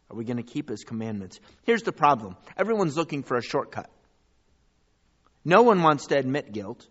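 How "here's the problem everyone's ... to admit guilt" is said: loud, driving preacher's build; sermon climax, loud; full-force preaching. Acted. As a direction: preach it out to the congregation. Closing rebuke to congregation.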